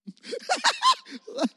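a burst of high giggling; high awkward laugh. Real, not acted. Pastor laughing at an awkward moment.

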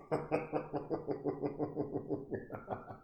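wheezy, voiceless run of laughs; real helpless wheezing laugh; tickled laughter. Real, not acted. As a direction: crack up at your own joke. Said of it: "I caught myself there with a funny".

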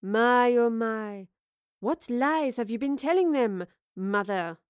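mock-shocked, swooping intonation; falling, knowing delivery of "mother"; mocking taunt. A narrator's reading. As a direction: toy with her, then land on the last word. Villain taunts her mother before others.

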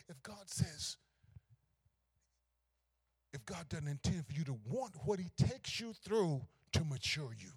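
ordinary talk, nothing marked

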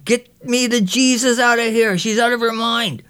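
high, panicked yell; frantic kid's high shout. Retold or imitated. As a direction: scream for help while being chased. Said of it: "Boots is shouting".

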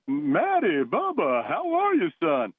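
high-pitched folksy coach voice, lifted way up; a high, sing-song old coach calling his player; imitating the old coach. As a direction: ham up the coach's cheerful greeting. Voicing coach greeting his player.

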